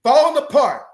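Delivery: preaching. Acted. Preaches to listeners.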